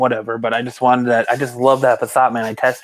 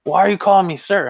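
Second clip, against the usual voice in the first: annoyed boss; 7 semitones higher than usual. Retold or imitated. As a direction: demand it like an irritated boss. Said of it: "boss quote".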